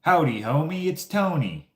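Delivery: high, loud, put-on Southern howdy; Southern accent. Chinese sign seller greeting Instagram viewers in mock Southern accent. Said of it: "somewhat Southern accent".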